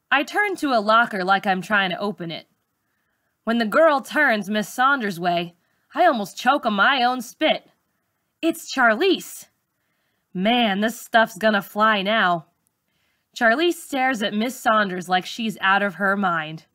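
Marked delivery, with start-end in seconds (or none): none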